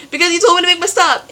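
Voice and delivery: fast, high, loud comeback; defensive retort. Retold or imitated. Student driver snapping back at instructor, fast and loud. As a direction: fire it back in frustration.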